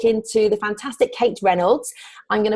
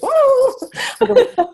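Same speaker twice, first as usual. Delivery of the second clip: really high pitched; 4 dB louder than usual. Shown as a demonstration. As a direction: voice jumps way up on a long drawn-out whoa. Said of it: "really high pitched and really, really fast".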